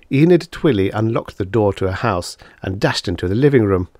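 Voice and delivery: usual speaking voice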